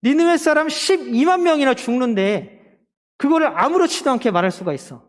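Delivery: high-pitched, impassioned scolding; God's rebuke. Acted. Preacher voices God challenging Jonah over Nineveh, impassioned rebuke. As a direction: confront someone with disbelief at their callousness. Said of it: "하나님 말씀하시는 거야".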